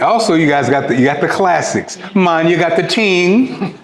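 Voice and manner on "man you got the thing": higher, slow, hammed-up Jamaican accent; playful, clumsy Jamaican accent for the drink names; bad Jamaican accent. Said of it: "That was a terrible accent".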